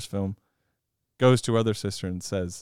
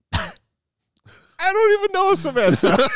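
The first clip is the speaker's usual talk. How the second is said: high, loud, puzzled voice; a bewildered little snowman; high, loud, baffled snowman voice; Olaf, puzzled; 4 dB louder than usual. Retold or imitated. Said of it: "he's like".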